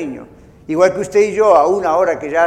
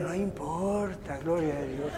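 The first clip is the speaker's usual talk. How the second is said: hushed, slow fake-holy calm; soft mock-saintly sigh; mock serene shrug; 3 semitones lower, 14 dB quieter than usual. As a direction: brush off the offence with sugary piety. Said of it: "Pero lo acaban de ofender".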